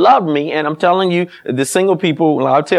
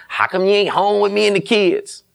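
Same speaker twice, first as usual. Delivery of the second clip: higher, put-on nagging wife voice; mock complaining wife; 8 semitones higher than usual. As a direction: whine it like the neglected wife. Mock wife scolding absent husband.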